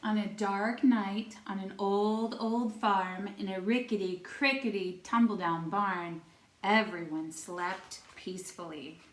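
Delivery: normal talking voice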